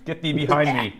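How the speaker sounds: high, loud theatrical command; mock banishing cry. Co-host fending off his partner, mock banishing cry. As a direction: cast out the tempter in jest.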